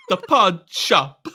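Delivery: high, loud, drawn-out theatrical echo; hammed-up imitation, stretching each word slowly; Moira. Co-host echoing the Moira imitation for laughs, high and drawn out. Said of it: "the way Moira speaks".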